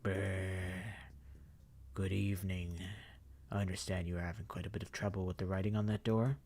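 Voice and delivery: a creepy host greeting them; eerie gentleman. Acted. The Professor appears.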